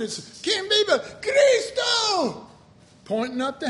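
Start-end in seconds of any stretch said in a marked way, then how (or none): 0.4-1.2 high-pitched imitated church call. Imitating how they would do it, high call-out. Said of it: "when they would do it". 1.2-2.5 high, drawn-out shouted response; congregation's shouted answer. Retold or imitated. Imitating everybody answering the church call, shouted. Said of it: "when they would do it".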